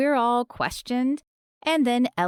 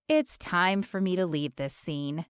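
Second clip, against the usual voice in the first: usual speaking voice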